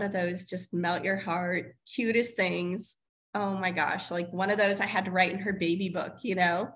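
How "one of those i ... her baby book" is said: usual speaking voice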